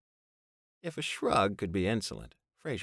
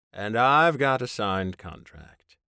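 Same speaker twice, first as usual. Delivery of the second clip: louder, slow, smug; smug Fraser; 3 semitones higher, 10 dB louder, slower than usual. A narrator's reading. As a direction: lay it down slowly and smugly. Fraser answers smugly.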